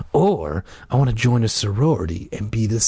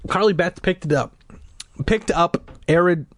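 usual speaking voice

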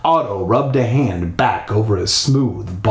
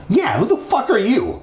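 sharp, much higher demand; angry demand; 9 semitones higher than usual. Acted. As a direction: bite at the boy, annoyed. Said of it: "she asked angrily".

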